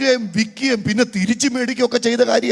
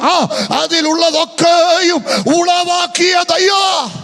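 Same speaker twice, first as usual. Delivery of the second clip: loud, high, slow sermon shout; full-force preaching; 4 semitones higher, 7 dB louder, slower than usual. Acted. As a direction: drive the climax home to the congregation. Sermon climax. (ആവേശത്തോടെ ഉറക്കെ പ്രസംഗിക്കുന്നു)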